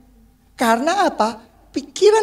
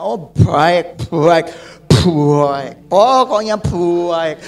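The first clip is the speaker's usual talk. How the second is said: lovestruck fool; 7 semitones lower, 3 dB louder, much slower than usual. Retold or imitated. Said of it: "kalau sudah cinta, buta semuanya".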